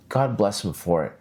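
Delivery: ordinary delivery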